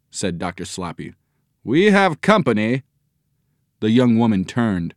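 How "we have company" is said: high-pitched announcement; raised, pointed call; calling to wife. A narrator's reading. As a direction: alert your wife to unexpected guests. Father alerts his wife.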